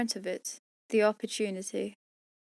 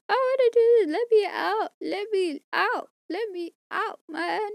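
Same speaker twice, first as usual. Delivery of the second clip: much higher, loud mock wail; mock whining; 10 semitones higher, 4 dB louder than usual. Exhausted reader pretending to beg to be let out, high whine. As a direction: whimper like a trapped kid begging.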